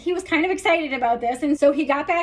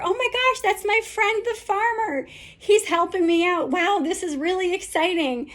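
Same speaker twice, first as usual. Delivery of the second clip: much higher, gushing excitement; thrilled donkey; 7 semitones higher than usual. Retold or imitated. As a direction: squeal with joy at seeing a friend.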